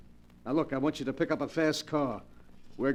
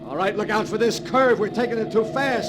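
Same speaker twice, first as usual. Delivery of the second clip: louder, higher warning yell in a speeding car; alarmed shout; 6 semitones higher, 6 dB louder than usual. Acted. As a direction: shout the warning over the engine. Car chase, taking curve.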